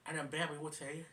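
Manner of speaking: ordinary talk, nothing marked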